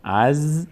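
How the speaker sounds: "از" with the z held and no vowel after it; teacher demonstrating a silent-vowel ز in "از"; vowelless consonant. Shown as a demonstration. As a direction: close the syllable "از" on a sustained ز. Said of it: "به صورت ساکن".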